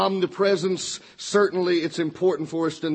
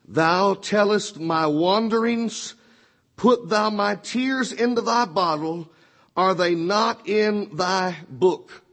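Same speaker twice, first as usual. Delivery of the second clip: put-on slow, higher, lingering reading of the old verse; scripture reading; 4 semitones higher, slower than usual. Preacher reading a favorite text, slow reverent reading. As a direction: let each phrase of the psalm hang. Reads Psalm fifty-six eight.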